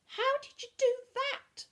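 very high, slow squeal of disbelief; astonished squeak. A narrator's reading. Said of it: "Bertolt squeaked, astonished".

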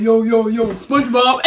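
loud mock shout to friends; Kiki on phone. Imitating Kiki yelling to friends on the phone at night, loud. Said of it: "talking about".

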